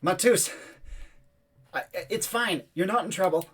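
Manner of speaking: calling out to friends. Acted. Boy coaxes hidden friends out, calling in a high voice. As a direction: pitch it up, coaxing them out of hiding. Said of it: "And he yells out".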